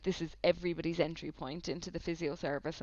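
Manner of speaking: normal talking voice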